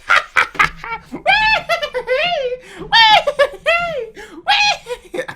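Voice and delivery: high, loud cackling howl; big screeching laugh. Retold or imitated. Hosts howling at the marriage joke, loud shrieking laugh. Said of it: "Everyone's laughing".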